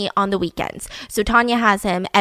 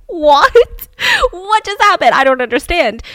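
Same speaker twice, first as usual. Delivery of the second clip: higher, louder imitating shocked disbelief; 7 semitones higher, 6 dB louder than usual. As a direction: imitate him hearing the news, stunned. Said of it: "So he's like".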